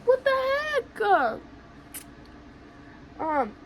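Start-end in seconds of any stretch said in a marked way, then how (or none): 0.0-1.4 high, drawn-out protest; mock outrage. Protesting being denied shelter, retold outrage. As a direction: wail it high and slow.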